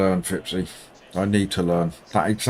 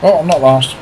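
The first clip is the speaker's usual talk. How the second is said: higher, louder sudden relief; surprised relief; 7 semitones higher, 7 dB louder than usual. Real, not acted. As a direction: burst out with delight. Discovers he is not last.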